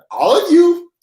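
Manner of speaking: high, loud call across the room; hammed-up parent summons; mock calling out. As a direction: yell for the kids to come over. Calling people over, mock parent.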